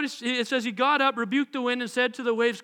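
ordinary delivery

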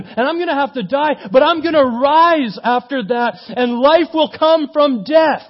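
loud, high, rousing proclamation; impassioned Jesus. Acted. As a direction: build to a fervent, triumphant cry. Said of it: "I think he might have started talking, saying".

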